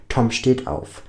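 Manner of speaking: ordinary delivery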